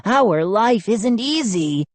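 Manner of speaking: high, slow rasping gnome plea. A narrator's reading. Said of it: "Chip rasped".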